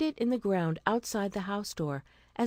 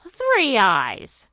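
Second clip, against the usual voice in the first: a loud commanding call; 3 semitones higher, 9 dB louder than usual. A narrator's reading. Mother calling her daughter to climb, loud. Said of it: "said the mother".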